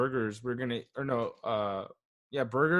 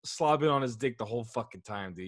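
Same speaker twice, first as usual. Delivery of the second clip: usual speaking voice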